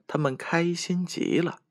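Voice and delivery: ordinary delivery, nothing marked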